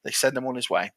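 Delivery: usual speaking voice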